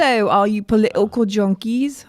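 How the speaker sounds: attempted Cockney greeting; hammy, shaky attempt at a Cockney accent; put-on English accent. Shown as a demonstration. Host trying out the show's opening with an accent, mock Cockney. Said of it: "I wanna say that with an accent".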